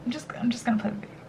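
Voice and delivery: quick, near-voiceless whisper; hushed aside. Real, not acted. Vlogger ashamed of a mistake moves on to the video, whispering. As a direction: whisper it hurriedly under your breath.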